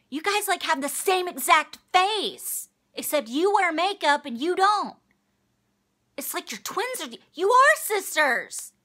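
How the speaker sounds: high-pitched mock amazement; in-your-face imitation. Shelby to the sisters about being sisters, high in-your-face imitation. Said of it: "she gets in their face".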